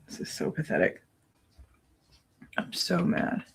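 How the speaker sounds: flat, disgusted whisper. Real, not acted. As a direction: mutter it under your breath in a whisper. Whispering during muted trial.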